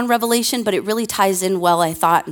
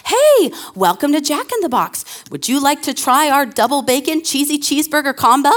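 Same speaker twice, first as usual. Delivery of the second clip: higher, chipper recorded greeting; automated drive-through voice; 6 semitones higher than usual. Retold or imitated. Mocking the Jack in the Box recording, higher and chipper. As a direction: sound like a canned sales pitch. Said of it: "automated voice comes on".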